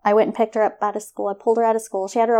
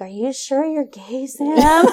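put-on teasing boys; 5 semitones higher, 4 dB louder than usual. Boys voiced teasing at prom.

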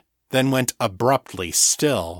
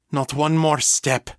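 higher-pitched, firm warning; 5 semitones higher than usual. A narrator's reading. Mother warning the man coming toward her, firm. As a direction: warn him off sharply. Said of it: "she warned him".

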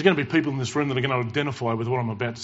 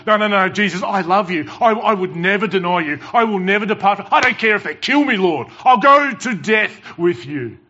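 higher, louder, insistent vow of loyalty; Peter protesting loudly; 6 semitones higher, 7 dB louder than usual. Acted. Peter swearing to Jesus he will never deny him, loud and pleading.

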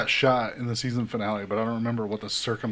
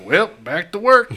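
casual mock villain; 10 semitones higher, 9 dB louder, slower than usual. Villain's casual line quoted.

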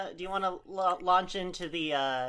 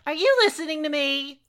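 exasperated; 9 semitones higher, 7 dB louder, faster than usual. Acted. Annoyed at being ignored.

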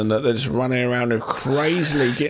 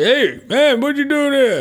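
raised, high challenge; calling out; 12 semitones higher, 6 dB louder than usual. Retold or imitated. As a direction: imitate someone calling out a challenge, high and loud. Voicing a character challenging Michael.

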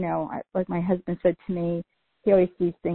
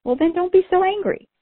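sharply higher and louder, telling her off; emphatic retort; 11 semitones higher, 6 dB louder than usual. Retold or imitated. To the furious lady, a loud high-pitched retort. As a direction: snap back the obvious answer, loud and high.

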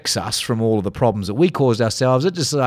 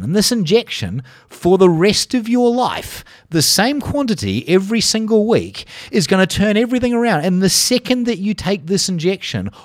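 higher, eager hard-sell pitch; salesman doctor pitch; 8 semitones higher than usual. Acted. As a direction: gush like a quack selling a miracle cure. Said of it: "an anti-aging doctor coming along who says".

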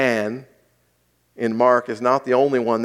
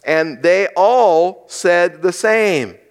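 slow emphasis; 5 semitones higher, 4 dB louder, much slower than usual. Shown as a demonstration. Scripture quote slowed for emphasis.

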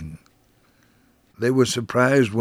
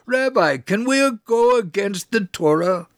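a much higher voice for another passenger calling across; voiced passenger; 11 semitones higher than usual. A narrator's reading. A passenger across the bus calls out to the rabbi, much higher voice. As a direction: pitch it way up as a different man asking.

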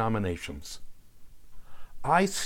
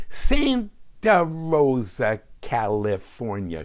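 put-on stretched-out vowels in a Brooklyn accent; sarcastic mother, drawing out "Santa Rosa" Brooklyn-style; sarcastic Brooklyn drawl; 3 dB louder, slower than usual. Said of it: "sarcastically extending the vowels in her Brooklyn accent".

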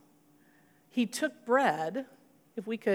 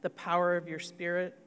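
prayer; 3 semitones lower, 5 dB quieter than usual. Acted. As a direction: pray quietly over the congregation. Leads closing prayer.

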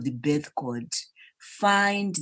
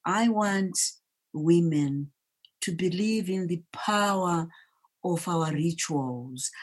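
normal talking voice

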